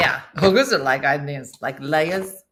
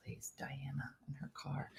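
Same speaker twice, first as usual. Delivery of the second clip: whispered aside; 5 semitones lower, 22 dB quieter than usual. Real, not acted. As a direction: whisper it behind your hand. Whispered aside about friend.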